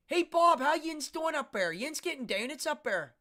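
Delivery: loud, high Pittsburgh Yinzer holler; hammed-up Pittsburgh accent with fronted O's. Shown as a demonstration. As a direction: shout to a pal in broad Pittsburgh. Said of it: "Pittsburgh also has the O sound".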